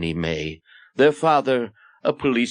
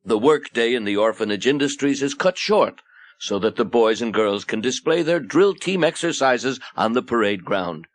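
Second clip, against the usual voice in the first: ordinary delivery, nothing marked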